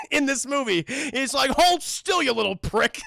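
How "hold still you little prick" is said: high, loud mocking villain snarl. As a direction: spit out a hammy villain threat. Villain's last line, quoted.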